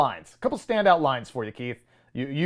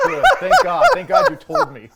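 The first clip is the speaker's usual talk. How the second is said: very high, loud laughing sarcasm; laughing mock gratitude; 6 dB louder than usual. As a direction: gush fake relief through helpless laughter. Mock gratitude for useless film warning.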